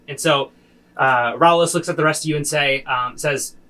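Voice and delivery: normal talking voice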